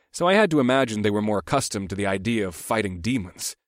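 ordinary delivery, nothing marked

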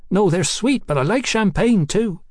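high-voiced cheeky London girl. A narrator's reading. Hetty hints for drink.

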